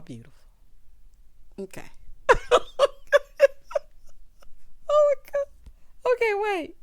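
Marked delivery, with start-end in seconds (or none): 1.9-4.9 high, breathless squealing laughter; real uncontrollable laughing fit; helpless cackle. Real, not acted. Cracks up over the feet. 5.0-6.8 high-pitched, still recovering from a laughing fit; real giggly attempt to regain composure; laughing breathlessly. Real, not acted.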